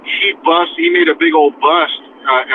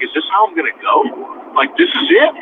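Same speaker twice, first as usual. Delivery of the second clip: blurted disbelief; 9 semitones higher than usual. Retold or imitated. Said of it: "I said it real quick, like, kind of, it wasn't under my breath, but it came out".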